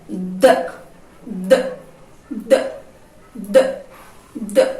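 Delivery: "D" as a short isolated d sound, not the letter name; short, separate "duh" bursts for "D"; letter's phoneme. Shown as a demonstration. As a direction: repeat "D" as a quick "duh", pausing between. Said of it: "qual é o fonema que a letrinha D representa?".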